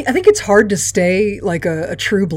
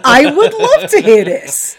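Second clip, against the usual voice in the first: laughing; 7 semitones higher, 6 dB louder than usual. Real, not acted. Amused reply to silly offer.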